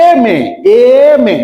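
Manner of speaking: teacher calling out এম এ to show its vowel start; loud demo pronunciation. Shown as a demonstration. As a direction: say এম এ loudly twice, opening on "em". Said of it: "তাহলে কি বলে?".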